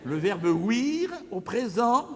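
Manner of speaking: ordinary talk, nothing marked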